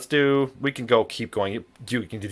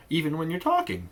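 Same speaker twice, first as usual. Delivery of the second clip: voice going high; 9 semitones higher than usual. Shown as a demonstration. Teacher shows voices go high and low when talking, rising. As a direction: pitch leaping up high. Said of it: "your voice always goes high and low".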